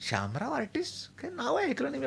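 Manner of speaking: host asking from under his blanket, softly puzzled; muffled under blanket. Retold or imitated. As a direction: murmur it back from under the sheet. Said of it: "मी पांघरुणाच्या आतून, आतनंच विचारलं".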